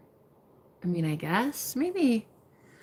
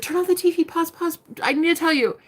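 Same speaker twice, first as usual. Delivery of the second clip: higher, urgent retelling of own plea; breathless excited request; 7 semitones higher, faster than usual. Retold or imitated. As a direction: urge him to stop the TV. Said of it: "And I'm like".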